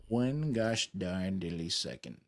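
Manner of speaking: ordinary talk, nothing marked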